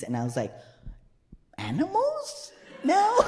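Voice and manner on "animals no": very high, squeaky baffled question; incredulous, pitched way up; mock bewilderment. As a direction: act out a clueless kid's disbelief. Child self baffled by birds and bees.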